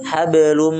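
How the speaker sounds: HABLUM with the final M held humming. Shown as a demonstration. Teacher modelling for students, held HABLUM. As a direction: hold on to the M of HABLUM. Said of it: "Hablum ditahan".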